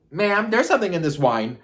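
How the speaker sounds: high, loud exclamation; loud, high-pitched disbelief; alarmed outburst. Retold or imitated. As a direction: call it out in mock alarm. Acted alarmed reaction to his outburst.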